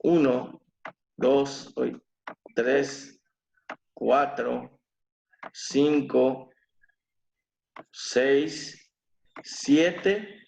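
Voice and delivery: slow count, one pause per syllable. Shown as a demonstration. Teacher recounting the eight syllables. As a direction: count the beats out deliberately. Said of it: "que tiene ocho sílabas".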